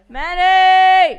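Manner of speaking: high, loud, drawn-out name call; announcer shout. Real, not acted. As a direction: announce him like a star. Grand announcement of a guest.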